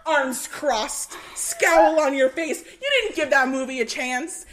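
ordinary talk, nothing marked

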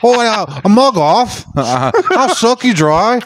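high, hammed-up British girlfriend voice; bad British accent. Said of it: "I gotta add a British accent to that".

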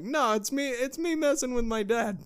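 high, playful protest; mock innocent protest. To his wife about teasing his dad, innocently. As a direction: sound falsely innocent. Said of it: "but I'm like".

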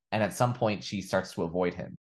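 ordinary talk, nothing marked

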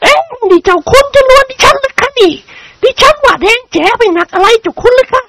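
high, sharp-tempered noblewoman; indignant lady. A narrator's reading. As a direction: snap back as the offended lady. Lady quarrels in novel. (คุณหญิงเสียงสูงโต้กลับ)

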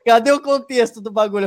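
high, incredulous protest broken by laughter; laughing protest. Retold or imitated. Retelling his reaction to being cut short, laughing. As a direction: laugh while demanding an explanation.

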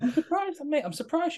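sudden high-pitched teasing surprise; surprised. Real, not acted. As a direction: voice jumps way up in mock disbelief. Reacting to friend's claim.

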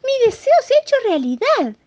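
very high, loud mouse squeal; overjoyed exclamation. Acted. Little mouse sees his wish come true, overjoyed exclamation. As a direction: cry out in tiny-mouse delight. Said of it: "exclamó".

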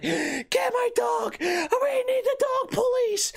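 very high, stretched-out lady's shriek; mock distressed wail. Lady whose dog is stolen, mock distressed wail. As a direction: wail for help in hammed-up falsetto. Acted lady crying for help.

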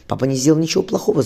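put-on a boy lashing out in defence of his father; angry. A narrator's reading. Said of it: "зло сказал Джон".

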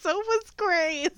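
high, slow teasing squeal; teasing high retort. Real, not acted. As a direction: sing it out as a tease. Teasing retort after laugh.

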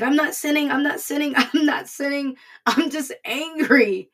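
high, loud mock-exasperated insistence; indignant retold protest. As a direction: protest your innocence, stretching out the last phrase. Retold protest to her mother.

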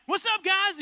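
high, loud cheerful host; Ty Pennington impression. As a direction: greet them like a hyped TV host. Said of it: "Ty's like".

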